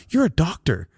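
high, incredulous complaint climbing to a loud squeal; mock exasperation. Patient questioning the doctor's guesswork, mounting mock exasperation. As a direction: protest in exaggerated disbelief. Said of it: "that's when I thought".

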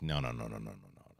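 low, fading string of nos; hushed refusal. Retold or imitated. Luthen refuses to kill Andor, hushed and low. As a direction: shake it off under your breath. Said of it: "Luthen, like".

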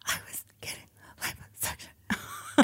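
very soft, unvoiced secret-telling; hushed confession. Brooke admitting it to a puzzled Elle, whispering. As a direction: whisper it as if nobody else may hear.

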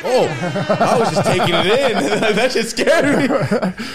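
high, loud, slow words through real laughter; laughing. Real, not acted. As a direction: laugh it out, overwhelmed. Laughing over his own success.

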